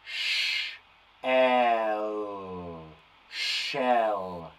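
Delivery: sh, l, then shell blended; sounding out. Shown as a demonstration. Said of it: "how many sounds have we got in there".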